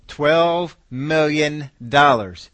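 twelve million dollars drawn out slowly, each word weighted; slow emphasis. Real, not acted. Preacher stresses the forgiven sum.

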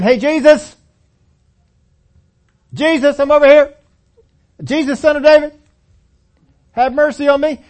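high, loud call across a crowd; mock yelling. Blind beggar hollering to Jesus over the crowd, loud. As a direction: holler for attention, hammed up. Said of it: "he's over there saying".

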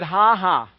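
loud, deliberate "ha ha" of defiance; Brother Hagin's laugh. Retold or imitated. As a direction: throw a forced laugh at the devil. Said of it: "he goes on with his famous laugh".

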